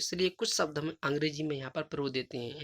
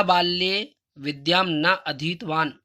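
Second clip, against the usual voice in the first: slow recitation; 2 semitones higher, 6 dB louder, slower than usual. Shown as a demonstration. Teacher reads Sanskrit question aloud.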